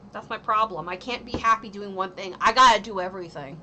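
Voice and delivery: usual speaking voice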